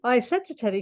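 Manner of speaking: ordinary delivery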